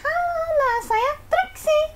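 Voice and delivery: high squeaky puppet voice; ventriloquist puppet voice. Pretend. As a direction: talk as the puppet, lips still. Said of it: "Oke, silakan. Ini namanya siapa?". (suara boneka ventriloquist)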